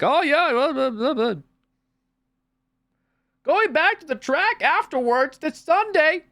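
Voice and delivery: high, loud sing-out mockery; mock older walkers. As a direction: imitate chattering older folks in a silly high voice. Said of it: "and they were like".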